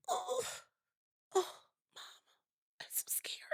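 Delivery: high then whispered, hammed-up fear; mock a child's melodramatic terror; dramatic scared whisper. Daughter telling mom she's scared, hammed-up and whispered. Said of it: "So dramatic".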